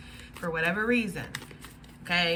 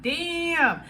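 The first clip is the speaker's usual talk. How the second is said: stunned damn; 8 semitones higher, 4 dB louder than usual. Retold or imitated. Her shocked reaction again.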